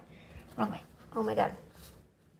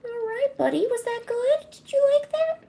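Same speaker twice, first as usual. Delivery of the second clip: much higher sing-song praise for the cat; pet talk; 11 semitones higher than usual. Real, not acted. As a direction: coo up high at the pet.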